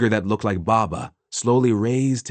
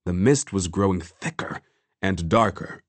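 plain reading voice

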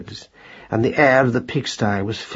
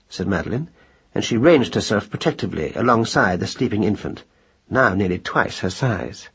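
ordinary delivery, nothing marked

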